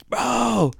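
high, drawn 'bro' calling a friend; stoned plea. Retold or imitated.

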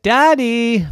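loud high call for Daddy. Acted. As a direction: call across the house for help. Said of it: "Chloe said".

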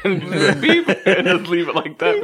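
giggling through the words; laughing. Real, not acted. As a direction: laugh while you say it. Laughing about censor beep.

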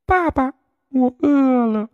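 very high little duckling voice; a cheerful chick remembering the egg; 小鸭子开心地说; happy duckling. A narrator's reading. Duckling asks for food.

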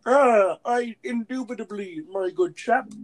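high, loud mock posh British accent. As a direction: ham up a pompous British gentleman. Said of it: "They just talk like".

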